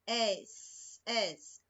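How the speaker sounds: "Es" said slowly as a drawn-out hissing S, twice; isolated sound. Shown as a demonstration. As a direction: stretch the "Es" into a clear S sound. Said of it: "Es, som de S". (destacar o som "Es" como um S sibilante)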